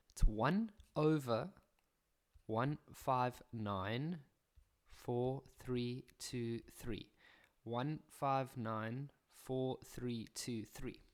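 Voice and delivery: slow dictation. Shown as a demonstration. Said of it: "dictating digits slowly".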